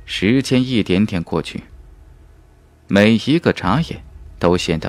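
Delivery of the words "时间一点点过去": plain reading voice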